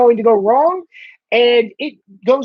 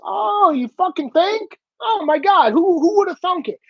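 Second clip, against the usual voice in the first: drip with sarcasm at them; sarcastic; 7 semitones higher than usual. Real, not acted. Sarcastic reply to media.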